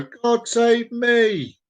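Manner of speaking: high, very drawn-out call; demonstrated cry. Acted. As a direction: stretch each word out high, as if leaping. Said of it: "You jump out and go".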